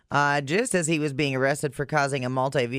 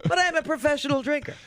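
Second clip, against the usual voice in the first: high, fast mocking drunk voice; mock drunk guy voice; 9 semitones higher than usual. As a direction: imitate the slurring drunk, sarcastically. Mocking the drunk guy's claim.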